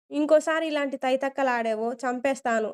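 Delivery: plain reading voice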